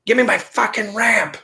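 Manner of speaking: high retold yell at the neighbors; mock yell. John yelling at the neighbors for his ramp, mock yell. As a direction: bark the demand, half-acted. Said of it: "and, like, yells it".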